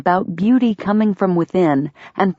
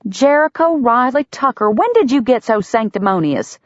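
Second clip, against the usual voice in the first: higher, full-name scolding; 7 semitones higher than usual. A narrator's reading. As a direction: scold like a big sister.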